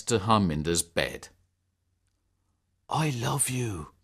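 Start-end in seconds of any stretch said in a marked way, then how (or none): 2.9-3.9 soft, slow, voiced near-whisper; mild whisper. A narrator's reading. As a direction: murmur it slowly and tenderly. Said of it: "Declan whispered".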